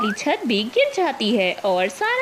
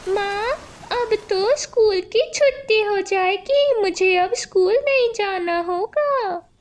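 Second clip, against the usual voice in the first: put-on higher child voice, gleeful; happy little boy; 8 semitones higher than usual. Little Anuj telling his mother there's no school, gleeful and high. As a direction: chirp like a kid glad school is off.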